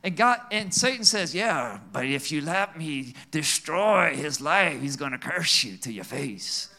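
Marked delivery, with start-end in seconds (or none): 1.3-6.7 devil voice. Acted. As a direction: growl it like the devil. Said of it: "That's my devil voice".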